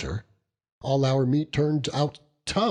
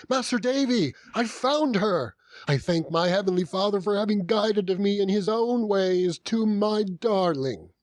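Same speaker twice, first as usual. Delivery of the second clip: much higher, breathless with joy; overjoyed news; 10 semitones higher than usual. A narrator's reading. Mr Peggotty rushing in to tell Davy he found her, overjoyed. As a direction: burst in with joyful news.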